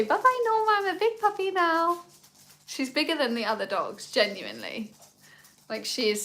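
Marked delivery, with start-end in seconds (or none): 0.0-2.0 put-on very high, squeaky baby voice for the dog; voicing the puppy. Owner voicing her puppy saying goodbye, squeaky high baby voice. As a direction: speak for the puppy in a tiny voice.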